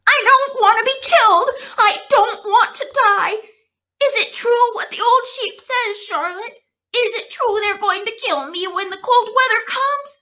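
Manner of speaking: very high, fast, frantic voice; terrified piglet pleading with Charlotte; hysterical panic. A narrator's reading. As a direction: babble it in terror. Wilbur hysterical, racing around.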